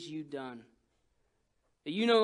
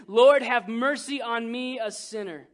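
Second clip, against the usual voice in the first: louder, raised plea; sinner's loud plea; pleading prayer; 4 semitones higher, 8 dB louder than usual. Retold or imitated. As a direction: cry out for mercy.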